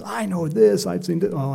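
high-pitched mimicry of a spiritual show-off; mock boasting. Preacher mimicking puffed-up people claiming visions, mock boasting. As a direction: imitate someone gushing about what they know.

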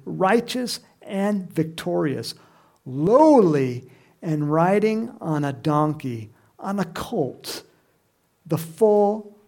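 slow, measured proclamation easing off; scripture reading. Acted. Preacher reading Zechariah's prophecy to the congregation, slow solemn reading.

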